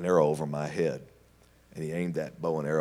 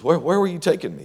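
higher, louder, stammering worry; alarmed question; 11 semitones higher, 6 dB louder than usual. Retold or imitated. Charlie nervously asking Tony where they are going. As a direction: panic a little. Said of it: "He says".

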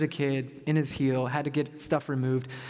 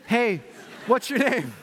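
higher-pitched hail to a stranger; calling out; 5 semitones higher than usual. Retold or imitated. Pastor hailing a limping stranger, calling out. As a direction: call over to someone passing.

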